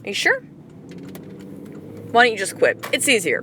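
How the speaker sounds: taunting universe. Retold or imitated. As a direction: goad her like a tempting voice. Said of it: "the universe going".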